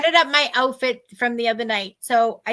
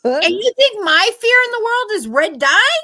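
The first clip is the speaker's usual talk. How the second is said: indignant disbelief; 8 semitones higher, 8 dB louder than usual. Retold or imitated. Mocking the woman's warning.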